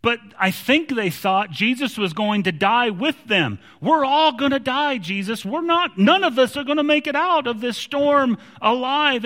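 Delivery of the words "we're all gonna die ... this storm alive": high-pitched desperate outcry; acted panic. Disciples to Jesus in the sinking boat, panicked. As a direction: raise the pitch as if all is lost.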